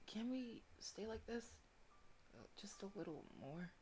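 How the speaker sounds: soft pleading. Acted. Character asking softly.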